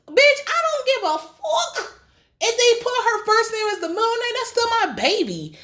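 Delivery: very high indignant outburst; outraged retort. Acted. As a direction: fire back indignantly, pitch sky-high. Outraged retort to the nun's request.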